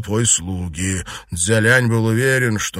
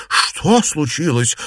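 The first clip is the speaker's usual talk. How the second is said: drawn-out, higher exclamation; slow, raised-pitch astonished question; startled exclamation; 5 semitones higher, slower than usual. A narrator's reading. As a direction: exclaim in alarm, stretching it out. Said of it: "воскликнула она".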